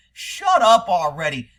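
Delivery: loud, slow, fed-up retort; exasperated dismissal. Retold or imitated. Fed-up viewer answering the official's explanation, exasperated. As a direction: cut off the nonsense with weary force.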